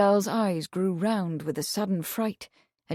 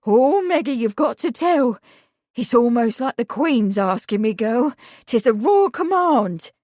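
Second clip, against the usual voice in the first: put-on louder, excited older woman; eager urging; 3 semitones higher, 6 dB louder than usual. A narrator's reading. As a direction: urge her on eagerly.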